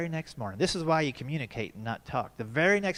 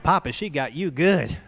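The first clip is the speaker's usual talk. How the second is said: lightly voiced little boy, slow and teasing; a hint of a kid gloating at his dad; 4 dB louder than usual. Son with the camcorder ribbing Papa, a lightly voiced gleeful kid. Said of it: "my little boy had the camcorders".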